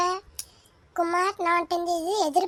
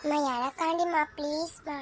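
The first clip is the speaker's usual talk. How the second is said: softer, pleading with mom; soft begging; 4 semitones lower, 7 dB quieter than usual. Acted. Little girl begging her mother to spare her brother, soft pleading. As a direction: beg gently, almost whispering please. Child begs mother not to punish.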